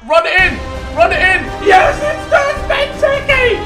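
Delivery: high, loud goal scream; excited shouting. Real, not acted. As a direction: scream with delight as it goes in. Goal being scored.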